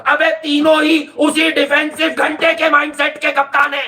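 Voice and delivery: high-pitched exasperated outburst; exasperated rant. Real, not acted. Pundit fed up with the same captaincy names, exasperated rant.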